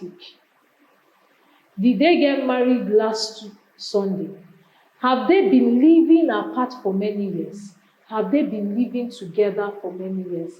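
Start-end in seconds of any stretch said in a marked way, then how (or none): none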